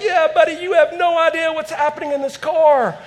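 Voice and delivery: a light put-on grin to the volunteers; lightly voiced fake friendliness; sarcastic reply. Sarcastic reply to waving parking team.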